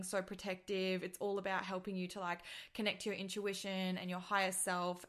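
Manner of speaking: ordinary talk, nothing marked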